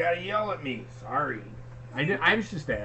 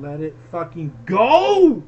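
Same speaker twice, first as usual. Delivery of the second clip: slow, louder, fed-up yell; exasperated shout; 3 semitones higher, 10 dB louder, slower than usual. Real, not acted. Husband yelling to drop the logo argument, fed up. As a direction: bark it out slowly.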